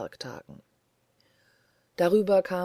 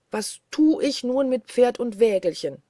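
loud, higher despairing cry; calling out; 6 semitones higher, 6 dB louder than usual. A narrator's reading. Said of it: "rief er". (verzweifelt laut gerufen)

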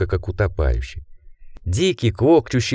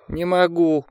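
higher, moaning plea; moaned; 8 semitones higher, slower than usual.

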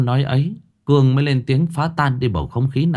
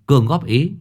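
usual speaking voice

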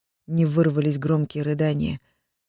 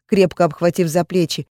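ordinary delivery, nothing marked

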